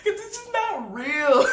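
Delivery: said high through helpless laughter; cracking up at how fake it is; laughing hard. Real, not acted. Vlogger reacting to the trailer, laughing hard.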